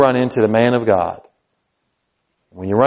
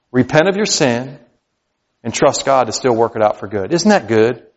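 usual speaking voice

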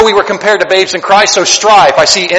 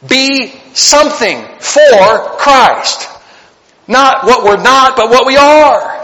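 higher, slow and deliberate; preacher stressing the lesson, slow and raised; slow emphatic preaching; 5 semitones higher, much slower than usual. Acted. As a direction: lean on every word of the key point. Preacher's key exhortation.

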